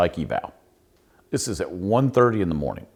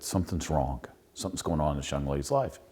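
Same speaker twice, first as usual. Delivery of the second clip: low, soft words meant only for her; hushed worry shared across the table; quiet aside to wife; 5 semitones lower, 10 dB quieter than usual. Retold or imitated. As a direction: lean in and say it softly. Whispered aside to his wife.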